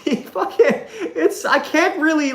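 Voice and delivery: high, laughing through words. Real, not acted. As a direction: crack up mid-sentence. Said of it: "He just goes".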